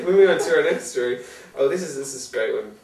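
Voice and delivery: ordinary delivery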